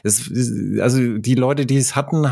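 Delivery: normal talking voice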